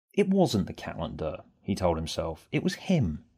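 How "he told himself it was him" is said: plain reading voice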